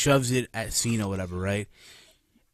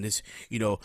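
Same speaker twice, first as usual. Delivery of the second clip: normal talking voice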